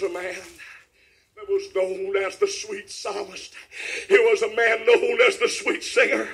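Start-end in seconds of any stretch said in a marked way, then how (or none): 4.1-6.3 rhythmic revival preaching climbing to a shout; preaching, building up. Real, not acted. Preacher telling of the weeping psalmist, building preaching.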